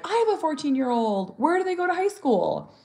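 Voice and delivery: bright high curious stranger asking. Retold or imitated. Stranger asking about her teen, high and curious. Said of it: "someone will be like".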